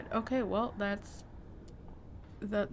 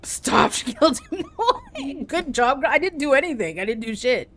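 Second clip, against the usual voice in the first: high, laughing slowly through the words; 5 semitones higher, 6 dB louder than usual. Real, not acted. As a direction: crack up while you say it. Laughing at NPC kill.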